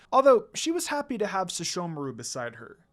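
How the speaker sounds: ordinary delivery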